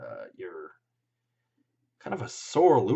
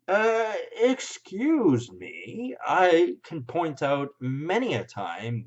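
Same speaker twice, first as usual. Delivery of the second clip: slow, high mock-polite protest; drawn-out indignant excuse me; sarcastic; 7 semitones higher, 4 dB louder, much slower than usual. Real, not acted.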